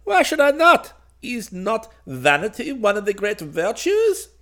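high, loud, astonished bird king; surprised voice. A narrator's reading. Vain king challenged by a child, high-pitched and surprised. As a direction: question it in piping royal disbelief. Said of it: "in a surprised voice".

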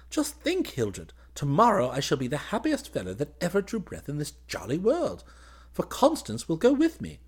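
put-on high, gushing, boyish delight; overjoyed young officer. Groom sharing his joy with his cousin, overjoyed.

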